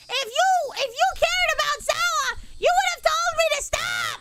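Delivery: betrayed shout at friends; furious accusation. Acted. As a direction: hurl the blame at them.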